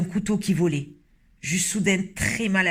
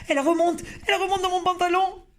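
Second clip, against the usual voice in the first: much higher, fast panicked squeal; panicked shriek; 13 semitones higher, faster than usual. Acted. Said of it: "cria l'homme".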